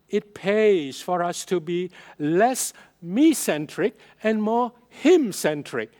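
ordinary talk

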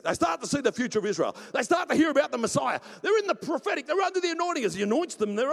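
high, loud, building excitement; fervent preaching. Real, not acted. Preacher describes elders starting to prophesy, shouting with excitement. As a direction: pile up the wonders, racing into a shout.

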